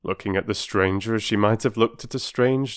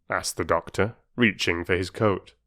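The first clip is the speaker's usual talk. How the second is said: ordinary delivery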